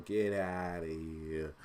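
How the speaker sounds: slow New York "get outta here"; New York accent. Shown as a demonstration. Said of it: "with my New York accent".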